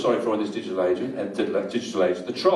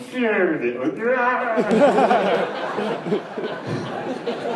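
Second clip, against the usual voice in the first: laughing; 8 semitones higher than usual. Real, not acted. Laughing at retold moment.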